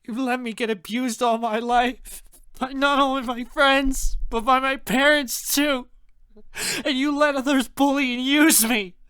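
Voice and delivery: high, loud, wailing accusation; sobbing. A narrator's reading. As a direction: cry it out through a fit of sobs. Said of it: "I dissolve into a fit of sobs".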